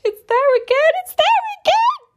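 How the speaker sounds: very high, loud squeal; real outburst climbing into a squeal; excited shriek. Real, not acted. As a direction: shriek it in giddy disbelief. Reader erupts at a recurring word.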